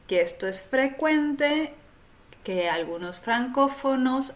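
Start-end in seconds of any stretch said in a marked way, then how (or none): none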